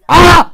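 sudden high shriek of fright; real startled cry; startled scream. Real, not acted. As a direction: yelp in real shock. Startled by something in game.

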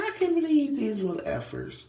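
high, loud outraged squeal; outraged disbelief. Retold or imitated. About the store's prices, high outraged squeal. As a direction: shriek it like you can't believe it.

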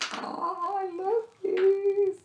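slow, high delighted coo over a cute find; high gushing squeal. Real, not acted. Crafter gushing to viewers over a cute sticker, high delighted coo. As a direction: melt over it in a high drawn-out voice.

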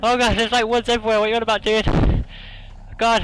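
high, loud frantic complaint; panicked shout. Real, not acted. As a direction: yell in fear at a friend. Panicking in dark woods.

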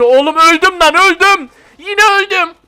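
loud high yell of frustration; frustrated shout. Real, not acted. Gamer dying again, yelling at the screen in frustration.

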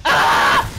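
short, loud shriek; alarmed scream. Real, not acted. Player attacked by a boomer, loud scream. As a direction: scream out in fright.

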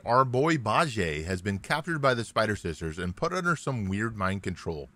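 ordinary talk, nothing marked

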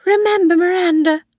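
put-on a frail old woman insisting darkly; old Mrs Harvey. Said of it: "Missus Harvey said again".

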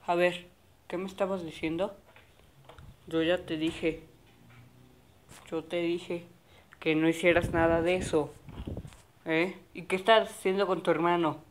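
low, soft, fast questioning; a dad interrogating his son; stern father. Acted. Father asks what he did with his brother, stern low.

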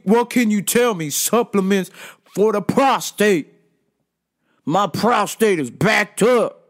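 mock demanding, emphatic voice; aggressive customer. Customer turning his demands on another worker, aggressively. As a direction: imitate a pushy man grudgingly giving in. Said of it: "the attitude is very aggressive".

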